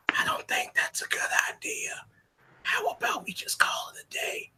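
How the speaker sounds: nervous unvoiced whisper. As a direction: whisper a worried plea at his side. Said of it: "just whispering".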